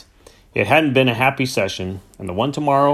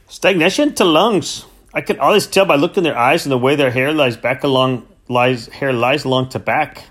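boastful knacker; 3 semitones higher than usual. Acted. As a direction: announce it like an expert nobody asked. Knacker Jeff's boastful diagnosis.

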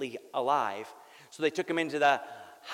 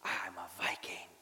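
whispered Viking claim; 8 semitones lower, 10 dB quieter than usual. Retold or imitated. Dying father murmurs belief.

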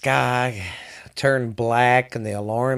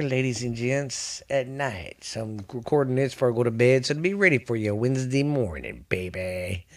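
ordinary talk

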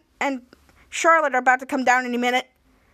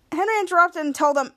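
usual speaking voice

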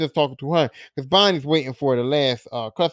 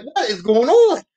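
higher, louder, slow, drawn-out disbelief; mock shock; 8 semitones higher, 5 dB louder, slower than usual. Real, not acted. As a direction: stretch it out like you can't believe it. Teller reacting to the scene.

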